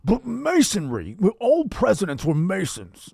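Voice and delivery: high, hammed-up senator voice; Bernie Sanders. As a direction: proclaim it like an old politician. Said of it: "your impression is".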